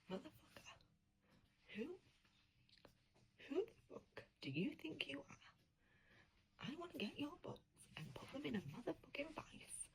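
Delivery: furious hissed whisper, barely voiced; seething under her breath at a book villain; whispered rant. Reader whispering threats at the fictional man, furious.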